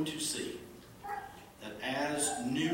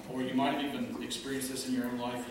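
ordinary talk

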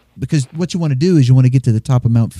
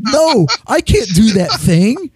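obnoxious American tourist; 10 semitones higher, slower than usual. Retold or imitated. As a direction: play a grating American tourist. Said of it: "really stilted".

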